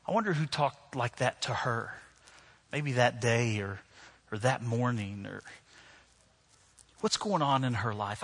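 soft, low musing with long pauses; hushed, compassionate reflection; quiet wondering. Retold or imitated. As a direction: think aloud gently, leaving space. Voicing his compassionate private thought.

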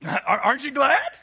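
high, loud laughing question; laughing joy. Real, not acted. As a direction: burst out glad and laughing.

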